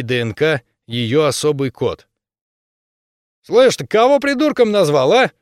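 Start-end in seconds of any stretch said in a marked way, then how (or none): 3.4-5.3 high, loud thuggish yell; a brawler spoiling for a fight; berserker shouting. Acted. Bully picks a fight.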